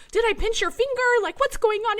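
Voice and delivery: high, loud, urgent question; mock-alarmed check on the child; worried mom, retold. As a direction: mom retelling her panic at the crying toddler. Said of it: "And so I was like".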